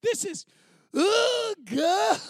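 high, loud laughing cry; breaking into sobs. Retold or imitated. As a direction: voice cracks high into a helpless laugh-sob. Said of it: "I just went from".

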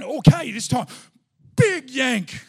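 loud burst on the big yank; effortful shout. Retold or imitated. Retelling his second try at the fishing line, effortful shout. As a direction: heave the words out loudly.

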